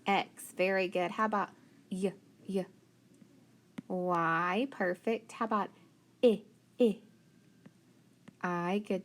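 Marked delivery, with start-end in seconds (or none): 1.9-2.6 short "y" glide, said twice apart; brief, separated "y" sounds; isolated letter sound. Shown as a demonstration. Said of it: "we're gonna try our spelling sounds". 6.2-7.0 "I" as a short "ih", twice; brief "ih" for "I", a pause between; short vowel sound. Shown as a demonstration. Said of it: "we're gonna try our spelling sounds".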